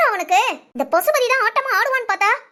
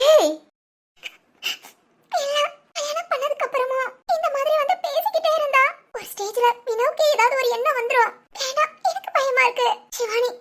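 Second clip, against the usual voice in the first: pleading through sobs, higher voice; crying; 4 semitones higher than usual. Acted. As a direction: beg tearfully to stop the wedding. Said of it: "முத அழுகைய நிப்பாட்டு". (அழுதபடி கெஞ்சும் குரல்)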